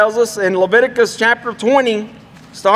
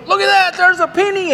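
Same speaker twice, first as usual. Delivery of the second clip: pitched way up in eager delight; higher, mock-eager penny spotting; excited; 8 semitones higher, faster than usual. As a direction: blurt it out like he struck gold. Stingy church member spots penny.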